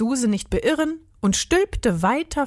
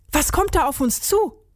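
high-pitched, keyed-up question; full of adrenaline; 6 semitones higher than usual. A narrator's reading. Dieter hearing wild flying noises, full of adrenaline. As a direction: ask it pumped up and alert. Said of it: "fragte Dieter voller Adrenalin".

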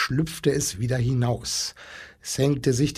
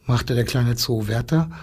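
ordinary delivery

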